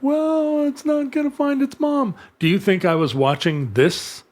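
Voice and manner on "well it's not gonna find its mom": high-pitched mock worry; worried film viewer. Imitating viewers worried for the seal pup, high mock-anxious voice. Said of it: "we're like".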